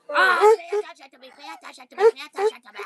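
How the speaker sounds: loud, fast, high whiny demand; dog shouting for attention. Retold or imitated. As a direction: bark it out like a needy dog. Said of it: "He's like".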